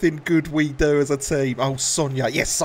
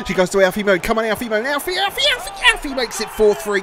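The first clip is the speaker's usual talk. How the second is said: higher, louder repeated name building to the goal; climbing gamer play-by-play; excited goal call; 7 semitones higher, 4 dB louder than usual. Real, not acted. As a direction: build frantically to the score.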